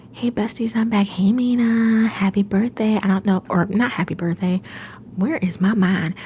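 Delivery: ordinary delivery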